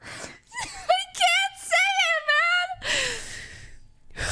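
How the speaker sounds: high, laughing, near-crying squeal; really cracking up, voice high and broken; cringe laughter. Real, not acted. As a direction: laugh through the embarrassment. Too embarrassed to say it.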